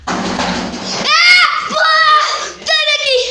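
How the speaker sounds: high startled shout, laughing; frightened scream. Real, not acted. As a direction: yell in sudden fright. Sudden fright in the dark.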